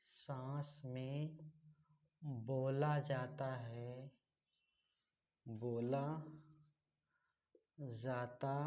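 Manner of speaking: slow, soft word-by-word dictation. Real, not acted. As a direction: dictate quietly, drawing out each word with long pauses. Teacher dictating a definition word by word.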